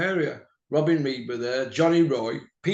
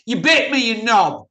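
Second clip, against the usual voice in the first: loud, higher angry yell; retold yell; 5 semitones higher, 9 dB louder than usual. Yelling at Dennis after he bit me, loud and high. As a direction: shout it at the biter in mock outrage.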